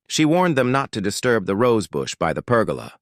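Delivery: usual speaking voice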